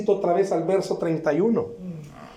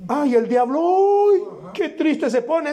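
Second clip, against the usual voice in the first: high mocking sing-song, pitch soaring up; mock the devil's sadness in a high whine; mock whiny voice; 9 semitones higher, 3 dB louder than usual. Mocking what churchgoers think.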